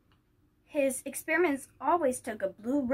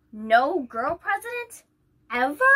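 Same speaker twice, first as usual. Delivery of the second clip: stunned disbelief; 4 semitones higher, 7 dB louder than usual. Acted. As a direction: ask it as if it can't be true. Grace stunned by teacher's answer.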